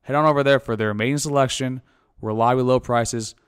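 loud, punchy sponsor read; hyped-up podcast host reading a sponsor spot. Acted. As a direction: sell it like a radio ad, hitting the promo code.

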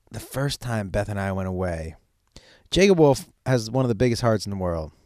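ordinary talk, nothing marked

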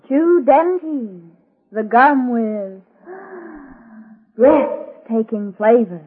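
drawn-out slogan with a dramatic gasp; slow ad slogan. Acted. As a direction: say it at half speed, gasping before breathtaking. Slogan of a gum advert.

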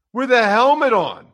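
outraged shout. Real, not acted. Outraged rant about a fine.

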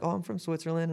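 put-on Swiss accent. Bartender telling a customer where she's from, light Swiss accent. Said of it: "a Swiss accent".

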